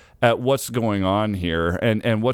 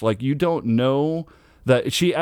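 ordinary delivery

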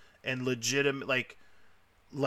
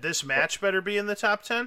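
incredulous echo; 7 semitones higher than usual. Retold or imitated. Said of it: "I said".

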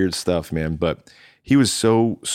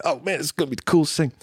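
high, fast mock-giddy voice; giddy excitement; 8 semitones higher, faster than usual. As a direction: replay your wide-eyed excitement in a raised pitch. Retold excitement arriving for tour.

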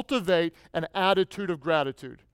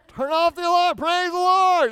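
high, loud shouted mash-up; shouting; 10 semitones higher, 5 dB louder than usual. Retold or imitated. Preacher replays his alarm yell as praise, shouting high. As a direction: holler it like one jumbled cry. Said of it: "you just shout out".

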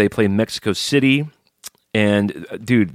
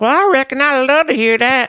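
hillbilly voice; 5 dB louder than usual. Pretend. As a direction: play a backwoods old-timer, pitched up. Hillbilly voice bit.

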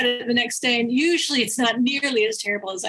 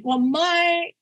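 ordinary talk, nothing marked

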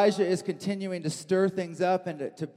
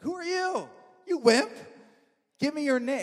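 higher, sneering mock queen; scornful queen; 7 semitones higher than usual. Jezebel mocking Ahab for giving up the vineyard, scornful. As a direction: scoff at him as a wimp, pitch up. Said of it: "She's like".

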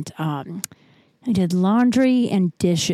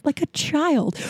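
high comic repeat; 8 semitones higher, slower than usual. Real, not acted. Repeat pitched way up for comic effect.

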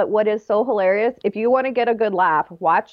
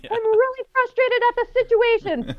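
much higher, hammed-up exasperated voice; mock frustrated spouse; 14 semitones higher than usual. A spouse telling her partner it's the situation, in a high mock-frustrated voice. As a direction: play a frustrated spouse, strained and high, insisting it's not personal. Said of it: "being like".

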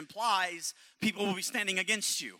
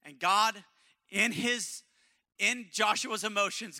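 ordinary talk, nothing marked

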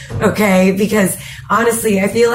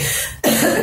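short breathy coughing fit; choking cough; 5 dB quieter than usual. Real, not acted. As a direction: cough as coffee goes down wrong. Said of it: "coffee went down the wrong hole".

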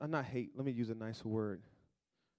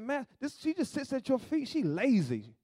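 high, complaining sister voice tattling to Jesus; mad Martha; 11 semitones higher, 5 dB louder than usual. Retold or imitated. Martha complaining to Jesus about her sister, high and aggrieved. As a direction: whine it up high like the one doing all the work. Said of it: "She got mad".

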